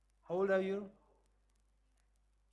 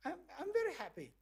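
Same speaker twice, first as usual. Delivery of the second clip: higher, perky reply; high answer; 7 semitones higher, 4 dB quieter, faster than usual. Shown as a demonstration. Voice in the box replying to the teacher, higher. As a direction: chirp it back brightly high.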